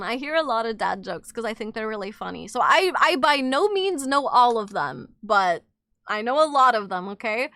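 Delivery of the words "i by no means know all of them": ordinary talk, nothing marked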